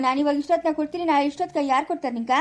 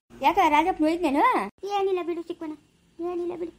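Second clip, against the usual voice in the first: ordinary talk, nothing marked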